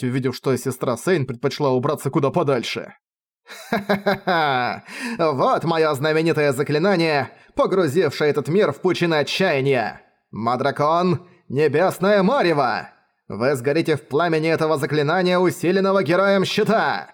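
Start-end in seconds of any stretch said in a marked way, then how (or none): 3.7-4.8 slow, gloating villain cackle; evil villain laugh. Acted. Villain laughs before his spell. 5.2-17.1 theatrical villain proclamation. Acted. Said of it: "Провозгласил Мадракон явно заранее заготовленную фразу".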